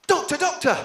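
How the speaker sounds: loud, high call of Doctor doctor. Shown as a demonstration. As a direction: shout for the doctor across a room. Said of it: "another classic would be something like".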